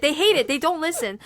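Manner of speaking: ordinary delivery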